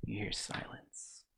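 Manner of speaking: soft, slow hushed whisper. Acted. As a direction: breathe the words out eerily. GM describes eerie silence.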